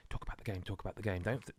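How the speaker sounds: soft self-reminder, repeated; inner reminder. Retold or imitated. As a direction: quietly coach yourself to stay on topic. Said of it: "I came here thinking".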